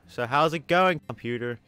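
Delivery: usual speaking voice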